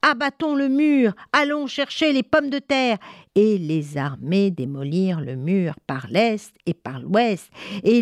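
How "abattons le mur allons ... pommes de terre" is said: high, rallying crowd chant; rally the troops to storm ahead; storyteller's battle cry without full volume; mock soldiers' yell. A narrator's reading. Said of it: "hurlaient les soldats".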